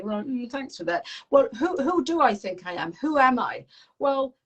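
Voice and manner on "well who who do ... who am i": defiant reply. Pretend. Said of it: "who do you think you are".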